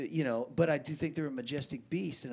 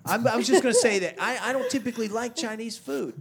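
laughing; 11 semitones higher, 11 dB louder than usual. Real, not acted. Laughing excited outburst about food.

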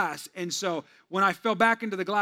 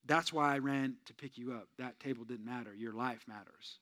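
soft sincere reassurance; 6 semitones lower, 7 dB quieter than usual. Retold or imitated.

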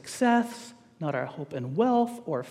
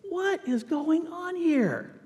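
high, drawn-out disbelief; acted bewilderment; 9 semitones higher, much slower than usual. Preacher voicing the reader confused by contradictions, acted bewilderment. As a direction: stretch it out, pitched up, utterly baffled. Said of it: "And you're just like".

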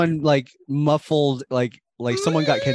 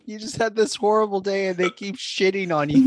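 laughing; 7 semitones higher than usual. Retold or imitated. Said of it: "Allison's just like".

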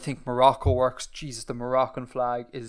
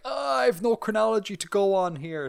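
higher-pitched imagined complaint; mock exasperation; 7 semitones higher than usual. Imagined muddled reaction to mail.

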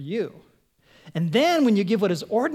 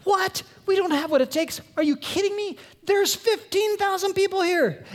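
panicked disciples; 11 semitones higher than usual. Retold or imitated. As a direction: squeal in alarm at the crowd. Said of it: "the disciples are going".

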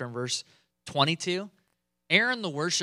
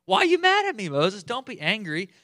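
much higher, loud whining plea; whiny Aaron; 6 semitones higher, 5 dB louder than usual. Retold or imitated. Aaron whining at angry Moses, high plea. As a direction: whine like you're being scolded. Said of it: "the most soft-spoken".

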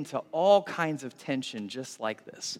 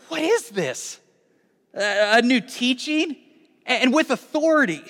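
high-pitched astonished questioning; amazed; 7 semitones higher, 5 dB louder than usual. A narrator's reading. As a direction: marvel aloud, pitch jumping up. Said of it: "The people were also amazed".

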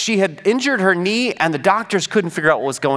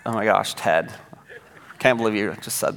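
put-on much lower, softer, exasperated teen voice; teen eye roll; 9 semitones lower, 6 dB quieter than usual. Sixteen-year-old Zoe rolling eyes at Ted, exasperated. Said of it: "the sixteen-year-old eye roll".

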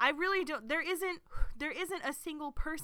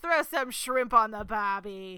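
loud, slow mock Australian; Australian accent; 8 dB louder, slower than usual. Showing off a stock phrase, in a loud Australian accent. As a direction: belt it out in drawn-out Australian. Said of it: "only thing that I can say in Australian".

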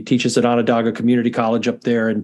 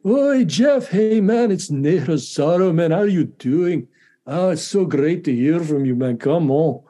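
high, bright, buoyant put-on accent, easing down as it goes; Ney Rosario impression; 4 semitones higher than usual. Phoning a percussion buddy, doing his friend's voice with a high, cheery accent. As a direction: greet him like an old friend, all warmth and swagger. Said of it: "I just love his, his accent and his whole affect".

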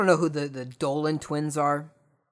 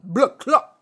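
put-on louder, higher Jamaican-accent blood clot; hammed-up Jamaican accent, higher and louder; 7 semitones higher, 7 dB louder than usual. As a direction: punch it out in a mock Jamaican accent. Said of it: "You know what they're saying there?".

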